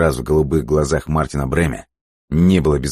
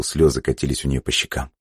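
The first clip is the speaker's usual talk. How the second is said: plain reading voice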